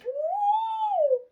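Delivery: very high loud whoop. Real, not acted. Mocking the copycats, whoops very high and loud. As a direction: let out a triumphant whoop. Triumphant cry.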